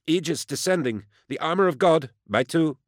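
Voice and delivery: high-pitched, hard-edged radio report; hard-edged, bitter. A narrator's reading. Medea reporting over the Vox link as she descends, hard-edged. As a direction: report in code with a bitter edge. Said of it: "her voice hard-edged and bitter".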